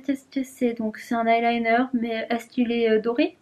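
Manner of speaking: normal talking voice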